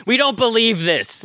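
high-pitched mock objection; mock audience protest. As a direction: play an indignant crowd, laughing into it. Mocking test audience's objection.

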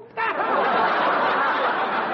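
high nervous giggle; embarrassed giggle. Real, not acted. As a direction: giggle at your own slip. Giggles at flubbing the word.

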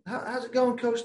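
put-on high, light American accent greeting the coach. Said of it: "That was my best American accent".

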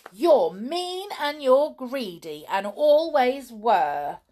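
loud, high scolding; heated quarrelling; angry accusation. Acted. Sneery accuses Snarl, quarrelling.

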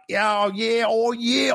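high-pitched mock broad Australian; overt Australian accent. Co-host mocking the accent that drives him insane, overt Australian. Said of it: "of the overt Australian accent".

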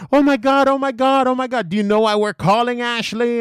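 imitating a thrilled radio host; excited radio host. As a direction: squeal it like an over-the-top radio host.

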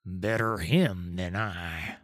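low, slow bitter snarl. Acted. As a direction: snarl it coldly. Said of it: "Tudor snarled".

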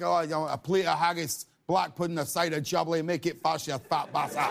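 put-on low-pitched comic Scottish accent. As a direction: order pub food like a Scot. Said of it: "sounds like a sort of Scottish side dish".

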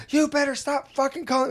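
high-pitched mock outrage; imitating fed-up wife. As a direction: warn the prank caller off in a shrieky female voice. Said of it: "She goes".

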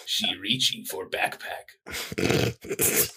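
very slow whispered caveman talk. Pretend. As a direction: breathe it out like a caveman. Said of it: "keep this up 'cause it's funny".

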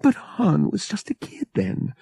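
high, pleading protest; fighting tears. A narrator's reading. Bria pointing it out to Pavak, fighting tears. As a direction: hold back tears as you argue. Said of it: "fighting not to give in to tears".

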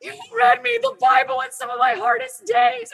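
high, teary voice reliving the sobbing; retold bawling. Telling the priest she's grateful, in a high teary voice. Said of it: "I'm bawling because I'm like".

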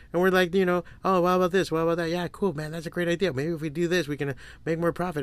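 high, fast, eager business chatter; eager brainstorm. Retold or imitated. To his business partner, eager, high and fast.

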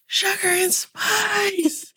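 high, drawn-out hail imitating a far-off friend; distant call. Older friend spotting the twins across the engineering mall, imitated calling out. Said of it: "we hear off in the distance".

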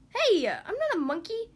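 high, loud indignant protest. Acted. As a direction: protest it, offended and shocked. Protesting the book's words.

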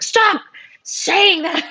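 high, strained protest; retold exasperation. To partner who doubts it will work, exasperated and high. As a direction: cut them off in a high, exasperated voice.

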